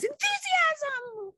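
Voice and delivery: high, slow, bubbly reveal of enthusiasm; cheerful high topic announcement; excited announcement. Acted.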